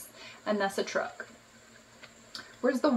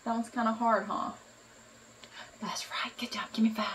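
normal talking voice

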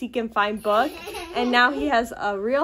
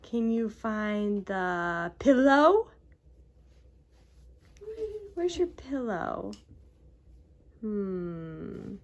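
put-on reading to a toddler; 2 semitones higher, 3 dB quieter, much slower than usual. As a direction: draw the little one into the game. Seek-and-find game with toddler.

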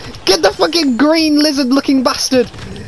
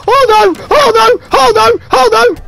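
loud, higher repeated alarm; panicked cries; 8 semitones higher, 4 dB louder than usual. Real, not acted. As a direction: panic as everything goes wrong.

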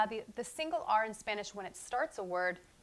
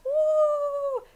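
cheer; 10 dB louder than usual. Real, not acted. Nearly done with alphabet.

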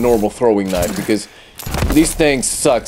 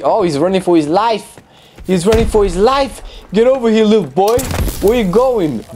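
mock the fleeing enemy as you chase him; gleeful hunter calling after prey; excited chase taunt; 6 semitones higher than usual. Real, not acted.

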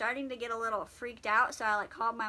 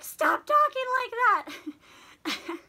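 alarmed mom; 13 semitones higher, 7 dB louder than usual. Retold or imitated. Mom cuts off dramatic farewell.

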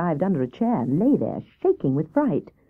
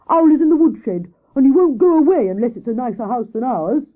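higher, louder, lightly voiced Hare; fretful Hare; 6 semitones higher, 5 dB louder than usual. Hare complains about Owl in the woodshed, mildly fretful.

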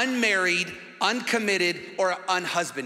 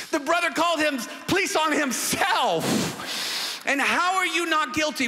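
incredulous outburst; 6 semitones higher than usual. Real, not acted. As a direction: throw up your hands in disbelief. Preacher outraged at court verdict.

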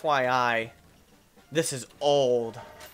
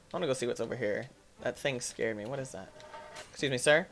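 usual speaking voice

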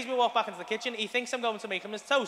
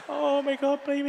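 tearful dismay; 6 semitones higher than usual. Retold or imitated. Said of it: "I'm crying my eyes out".

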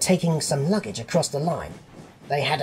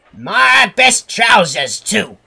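loud, high, drawn-out indignation; outraged; 8 semitones higher, 11 dB louder, slower than usual. A narrator's reading. Fat controller discovering his ruined trousers, outraged. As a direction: lament it with slow, loud outrage.

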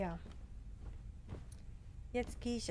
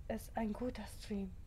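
whisper; 2 semitones lower, 8 dB quieter than usual. Real, not acted. Whispered aside to herself.

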